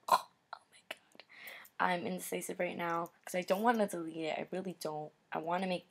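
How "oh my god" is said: whispered. Real, not acted. Flustered aside to herself.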